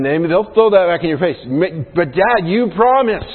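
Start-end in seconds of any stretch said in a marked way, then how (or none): 1.4-3.2 put-on whiny kid's voice, pitched up; mock little kid begging Dad; child pleading.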